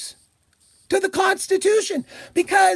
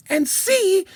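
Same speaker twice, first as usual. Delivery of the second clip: ordinary delivery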